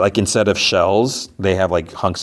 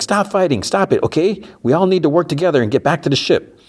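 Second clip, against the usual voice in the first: high-pitched mock pleading; exasperated pleading; 9 semitones higher than usual. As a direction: break up a squabble, urgent and pitched up. Acts out breaking up a quarrel.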